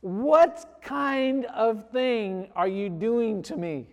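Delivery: loud, high, slowly stretched protest; hammed-up indignant disbelief; mock outrage. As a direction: wail it like a betrayed husband. Acted outrage at wife.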